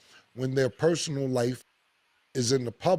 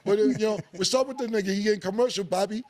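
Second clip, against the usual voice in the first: imitating barbershop critics; 7 semitones higher, faster than usual. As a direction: play the annoyed barber customer heckling Cam. Barbershop guys continuing.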